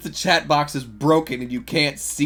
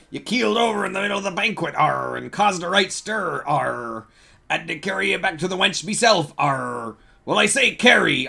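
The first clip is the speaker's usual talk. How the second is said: hammed-up pirate; 3 semitones higher, 5 dB louder than usual. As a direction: play an over-the-top sea dog. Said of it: "I was too piratey".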